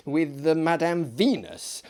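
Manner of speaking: plain reading voice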